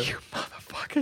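soft, voiceless cursing; whispered insult in character; muttered swearing. Acted. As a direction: curse them under your breath. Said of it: "Grayson swears".

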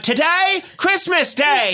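very high Victorian urchin voice; hammed-up, high British lad; Colin. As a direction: answer like an eager Dickensian street boy. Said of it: "He went".

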